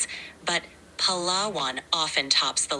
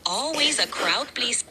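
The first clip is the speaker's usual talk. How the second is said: higher-pitched Bisaya-accented English; 6 semitones higher than usual. Shown as a demonstration. Assistant describing lumpia to amused users, Bisaya accent. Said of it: "All right, I'll try".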